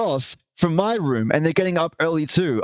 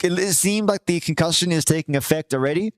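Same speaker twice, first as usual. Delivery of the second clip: ordinary delivery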